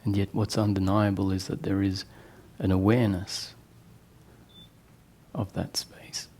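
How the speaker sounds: soft meditation voice with long pauses; guided meditation. Real, not acted. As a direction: guide them gently inward, leaving long silences. Leads eyes-closed guided meditation.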